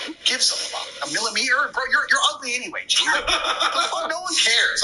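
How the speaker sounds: high scoffing mock voice; scornful inner thoughts. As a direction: sneer at the fussy customer. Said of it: "and I'm like".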